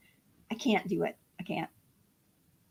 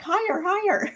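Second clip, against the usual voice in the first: put-on kid voice; 10 semitones higher than usual. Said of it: "It's like".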